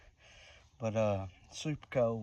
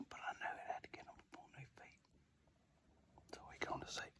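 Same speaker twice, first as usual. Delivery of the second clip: hushed whisper in the woods; 6 dB quieter than usual. Real, not acted. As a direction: keep it to a whisper.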